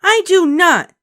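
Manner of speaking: put-on high, loud child shout. A narrator's reading. Ramona shouting at her sister, high and loud. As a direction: shout it back. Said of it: "shouted Ramona".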